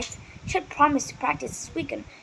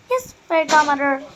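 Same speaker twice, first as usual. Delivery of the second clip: usual speaking voice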